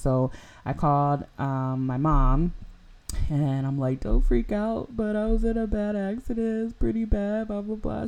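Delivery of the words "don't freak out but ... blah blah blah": much higher, strained upset voice; shaky, near tears, trying to stay calm; upset call. Retold or imitated. Calling mom after the crash, upset and near tears.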